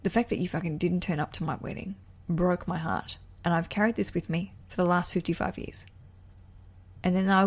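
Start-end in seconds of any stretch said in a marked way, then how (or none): 0.0-5.9 man confessing long-buried hurt. Acted. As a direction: softly voice a bloke finally unburdening himself. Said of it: "they'll say".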